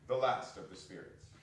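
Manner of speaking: ordinary talk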